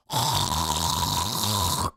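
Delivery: long, low throat-hawking noise; drawn-out mock throat-clear; imitated throat clearing. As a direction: hawk up phlegm like grandpa. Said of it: "make the loudest possible noise".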